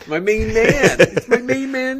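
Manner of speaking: high, loud hammed-up my main man; outdated greeting. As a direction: ham up an old-fashioned greeting. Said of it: "some outdated".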